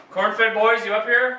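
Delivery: calling out. Real, not acted. Calling to others upstairs.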